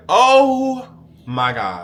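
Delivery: slow, drawn-out, high and loud oh my God; stunned disbelief, stretched out; shocked gasp. Retold or imitated. Hearing his agent call him good boy, drawn-out shock.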